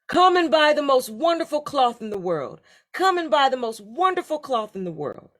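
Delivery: high, loud market call. A narrator's reading. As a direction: cry out to draw a crowd of buyers. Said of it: "called out".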